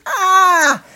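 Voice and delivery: high-pitched mock shriek; imitated shriek. A woman as the foul ball comes at her, mock shriek. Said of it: "she lets out a shriek".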